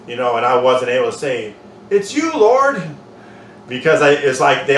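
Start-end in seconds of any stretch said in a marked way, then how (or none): 1.9-2.8 high, louder awestruck cry; awed disciple. Pretend. Disciple suddenly recognizing Jesus on the shore, awed cry. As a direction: blurt it in amazement at seeing him.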